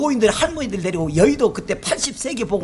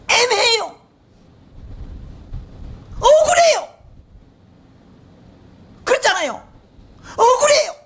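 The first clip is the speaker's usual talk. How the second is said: anguished outcry; 11 semitones higher than usual. Acted. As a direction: cry out the unfairness high. Preacher crying out Job's injustice. (억울함을 높고 크게 외치듯)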